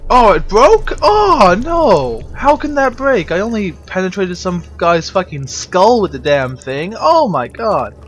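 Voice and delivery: high, loud exasperated ranting; frustrated loud outburst. Real, not acted. Player yelling at the game when the tool breaks, loud exasperation.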